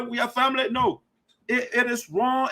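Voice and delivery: normal talking voice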